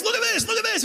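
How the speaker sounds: high, eager calling; excited showing off. Retold or imitated. Comedian showing friends a profile, high and eager. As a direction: grab your friends and point.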